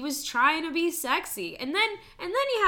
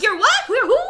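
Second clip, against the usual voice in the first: mock disbelief; 12 semitones higher, 14 dB louder than usual. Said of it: "and I'm like".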